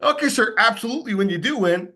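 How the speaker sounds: mock salesman cheer, starting high; hammed-up upbeat patter, blind to the bad news; inappropriately chirpy. Shown as a demonstration. Salesman answering a widower's bad news, wildly inappropriate cheer. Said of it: "I look like a nut job".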